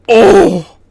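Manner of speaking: sudden loud oh; loud exclamation. Real, not acted. Vlogger reacting to the baffling moment, loud oh. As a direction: burst out in dismay.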